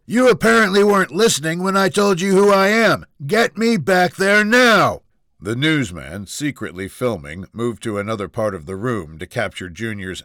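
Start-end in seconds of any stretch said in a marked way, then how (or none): 0.0-5.0 high, loud demand, dragging the final order; furious shouting. Acted. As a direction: yell at the receptionist in a temper. Junior's tantrum at receptionist continues.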